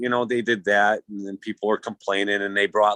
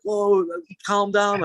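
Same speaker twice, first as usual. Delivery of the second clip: calming local guy; 12 semitones higher, slower than usual. Retold or imitated. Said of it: "he's like".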